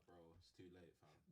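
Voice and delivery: a barely voiced, fed-up mumble; deflated aside. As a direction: let the air out of it, unimpressed. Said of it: "I'm just like".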